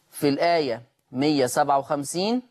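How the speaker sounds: ordinary talk, nothing marked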